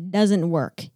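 ordinary talk

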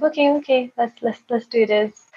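high-pitched, lightly acted going along; retold agreement. Woman agreeing to ten minutes of kissing, high retold agreement. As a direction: agree quickly while secretly doubting. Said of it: "I'm like".

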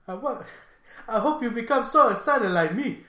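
high, put-on African accent; mock African accent. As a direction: keep up the excited African-accent impression. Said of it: "that's enough of that".

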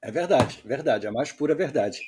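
ordinary delivery

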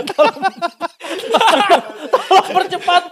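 laughing. Acted. Pleading mock job applicant.

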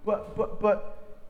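whispered flustered stammer; mock stalling; lightly whispered but-but; stammering excuse. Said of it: "There's no".

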